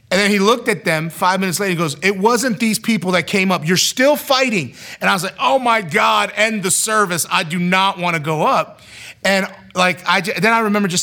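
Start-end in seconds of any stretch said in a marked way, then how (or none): 2.0-4.7 raised, insistent pulpit voice; preacher calling out. Retold or imitated. Preacher calls people to the altar. 5.4-8.8 loud, high mock panic; exasperated dread. Retells his panicked plea to himself.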